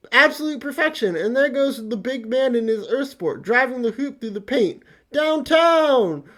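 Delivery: hammed-up announcer yelling "Downtown!"; mock sportscaster. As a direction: call it like a live basketball game. Mock sports commentary on art.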